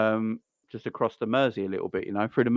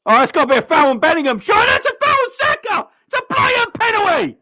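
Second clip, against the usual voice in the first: much higher, louder, fast outraged yelling; furious shout; 10 dB louder than usual. Real, not acted. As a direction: scream at the ref for a penalty. Outraged at a missed foul.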